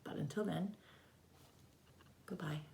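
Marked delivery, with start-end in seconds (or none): none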